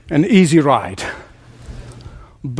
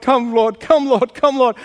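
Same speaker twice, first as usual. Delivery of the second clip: begging; 6 semitones higher than usual. Retold or imitated. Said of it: "as he was begging the Lord".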